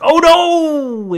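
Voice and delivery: high, loud drawn-out mock dismay; host's catchphrase cry. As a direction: imitate a game show host crying out in dismay. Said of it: "He goes like".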